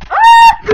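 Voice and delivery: sudden high, very loud shriek; startled scream. Real, not acted. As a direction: cry out mid-sentence, very high and loud. Startled mid-sentence, dog beside her.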